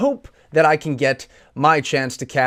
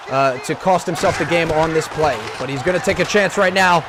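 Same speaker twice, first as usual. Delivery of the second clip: energetic play-by-play commentary; esports caster; 3 semitones higher than usual. Acted. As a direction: call the game like a live caster. Casts Madden gameplay play-by-play.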